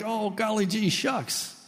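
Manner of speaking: high, aw-shucks bashfulness; mock false modesty. Mimicking false pride to the congregation, mock bashful. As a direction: put on exaggerated humble reluctance. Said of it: "That's false pride to act like".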